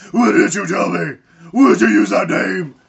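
loud, hammed-up demanding questions; mock accusing hero. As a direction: confront him like a betrayed hero. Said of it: "and he's like".

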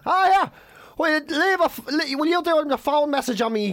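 high-pitched, pushy fan request; eager fan. Retold or imitated. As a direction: imitate an excited stranger asking a favour. Fan approaching him for favour.

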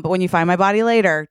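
ordinary talk

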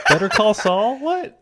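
high, loud, laughing disbelief; incredulous surprise. Real, not acted. Host reacts to cohost's Odenkirk trivia, loudly incredulous. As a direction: exclaim it way up high, astonished.

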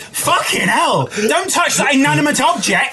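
high-pitched indignant outburst; mock outrage. Co-host protesting in banter, mock outrage. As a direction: exclaim in exaggerated protest.

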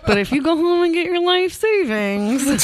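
high, slow carnival huckster; hammed-up fairground pitch; carny hustler. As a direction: draw it out like a carny luring a mark. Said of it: "that Carney guy who's like".

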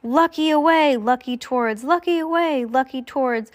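two rivals trading urgent orders; duelling commands. A narrator's reading. Rival commands shouted back and forth.

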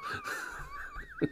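long high laugh. Real, not acted. At his own lunges pun, laughing hard. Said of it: "wait for you to stop laughing".

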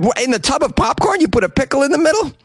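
can't believe what you just read; high, fast double-take at a pickle in popcorn; incredulous surprise. Real, not acted. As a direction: pitch leaps way up, quick and disbelieving.

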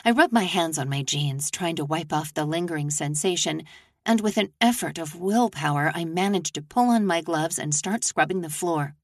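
ordinary delivery

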